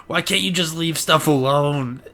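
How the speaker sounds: lightly voiced old hag complaining, drawn out; slow mock yell of a cranky neighbor; imitated nagging hag. Friend imitates old neighbor scolding dad, mock nagging. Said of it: "old hag raven walks out of her house and yells at my dad".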